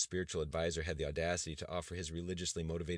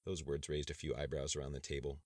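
usual speaking voice

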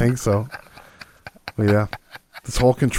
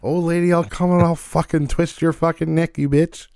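high, hammed-up murderous rant; mock threat; 8 semitones higher than usual. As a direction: play a crazed neighbor threatening an old lady. Mock threat to loud neighbor.

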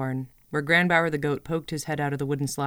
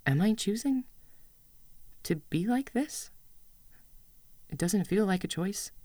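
put-on higher, wounded child asking; hurt young voice; 5 semitones higher than usual. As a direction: let the hurt show a little. Hurt child questions mother.

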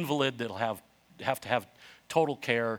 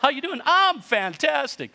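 lying voice; 12 semitones higher, 5 dB louder than usual. Retold or imitated. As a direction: overplay the fine answer, pitched up. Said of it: "That's how you know somebody's lying".

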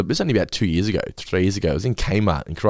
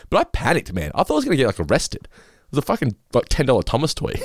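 ordinary talk

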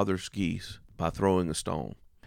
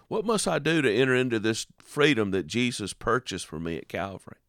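usual speaking voice

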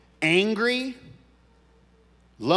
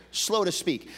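usual speaking voice

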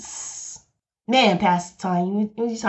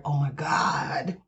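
much softer and lower, voice breaking into a cry, slowly; tearful whisper; 7 semitones lower, 8 dB quieter, slower than usual. Real, not acted. Pastor overcome with gratitude on her podcast, crying softly. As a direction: let the tears catch the words.

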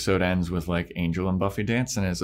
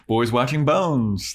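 announcer pitch; 6 semitones higher, 4 dB louder, slower than usual. Pretend. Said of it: "There we go".

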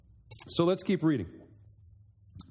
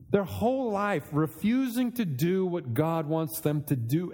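usual speaking voice

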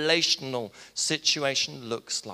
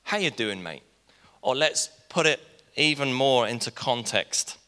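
ordinary talk

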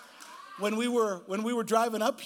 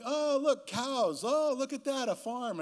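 mock tour guide; 5 semitones higher, slower than usual. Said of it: "just kinda giving a tour guide".

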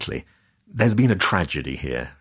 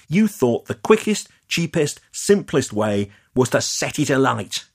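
loud, higher, heated accusation; angry accusation; 6 semitones higher, 3 dB louder than usual. Acted. Leah accusing the developer of arson, loud and heated. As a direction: hurl the blame at him, voice climbing.

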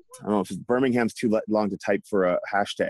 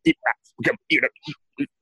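imitating a garbled frozen call; mock broken-up audio; much slower than usual. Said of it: "That's what I got from you".

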